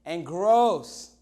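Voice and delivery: gross, said with real distaste; disgusted. Real, not acted. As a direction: spit out the word gross. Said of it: "just nasty".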